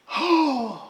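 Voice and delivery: high, drawn gasp of relief; gasp of realization. Real, not acted. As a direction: gasp as it finally comes back. Gasp after recalling the forgotten name.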